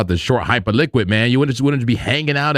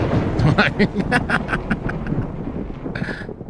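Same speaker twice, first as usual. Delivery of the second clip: high, breathless wheezing laugh; laughing fit; 7 semitones higher, 4 dB quieter than usual. Real, not acted. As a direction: crack up in a long, trailing laugh. Cracking up at own phrase.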